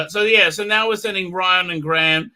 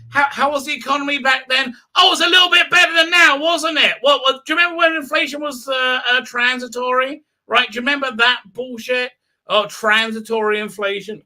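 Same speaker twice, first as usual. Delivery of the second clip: worked-up rant; 8 semitones higher than usual. Real, not acted. Ranting to viewers about inflation, worked up. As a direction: rant like you can't believe it.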